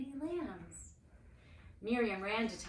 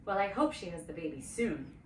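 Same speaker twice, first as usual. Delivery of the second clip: grumbling; 3 semitones lower, 4 dB louder than usual. A narrator's reading. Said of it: "Aaron grumbled".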